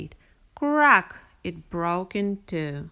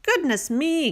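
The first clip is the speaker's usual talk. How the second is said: higher astonished gasp; startled exclamation; 8 semitones higher than usual. A narrator's reading. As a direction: caught by surprise. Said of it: "gasped Mister Rabbit".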